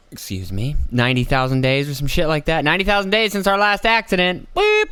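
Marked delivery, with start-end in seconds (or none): 2.6-4.5 higher-pitched mock safety announcement. Reading out the accident-free sign, mock announcement. 4.6-4.9 very high, short "boop"; imitated beep. Counter being turned over, imitated high beep.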